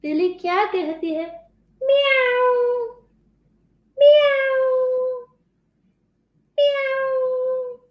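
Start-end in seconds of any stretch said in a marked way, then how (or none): 1.9-7.8 high, long, drawn-out meow; high singsong kitty call; cat meow. Shown as a demonstration. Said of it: "what does the cat say".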